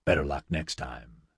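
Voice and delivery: low, soft mock consolation; dry retort. A narrator's reading. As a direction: hand the jibe back quietly and dryly. Nigel returns senator's jibe.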